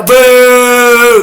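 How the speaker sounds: loud, high, long boo; drawn-out crowd heckle; jeering boo. Real, not acted. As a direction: boo the rival team like a fan in the stands. Jeers the opposing team.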